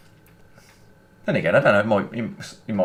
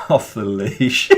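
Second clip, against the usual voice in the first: laughing; 3 dB louder, much slower than usual. Real, not acted. Amused at own American word.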